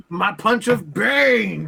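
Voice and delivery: loud, high, drawn-out attack call; mock battle cry. Player jokingly announces a punch of Bane, mock battle cry. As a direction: stretch it out like a spell being cast.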